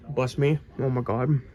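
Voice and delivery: ordinary talk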